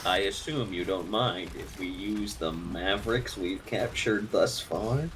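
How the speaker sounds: low and calm like a villain; deep character voice. Retold or imitated. Quoting a game character.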